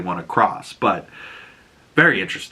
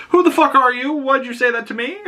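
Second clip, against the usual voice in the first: put-on angry boy shout; 13 semitones higher than usual. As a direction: shout it up high at the fortune teller. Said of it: "Egg will go up to her like".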